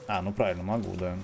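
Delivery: normal talking voice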